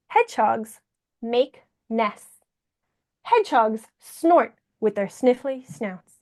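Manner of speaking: theatrical storytime voice with long pauses; swoon, then fret melodramatically for young listeners; hammed-up picture-book reading, key phrases stretched; Jacques the dramatic hedgehog.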